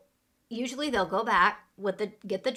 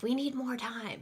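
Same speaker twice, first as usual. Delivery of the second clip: higher-pitched, pressured demand; stressed; 5 semitones higher, 4 dB quieter than usual. Shown as a demonstration. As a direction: sound stressed and a bit impolite. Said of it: "It sounds like you're stressed".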